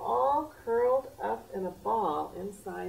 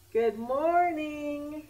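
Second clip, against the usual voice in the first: high, slow call out the window; 7 semitones higher, 6 dB louder, much slower than usual. Acted. Hen calls out the window to her neighbours, high and drawn out. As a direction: stretch the greeting out to the neighbours. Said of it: "she called".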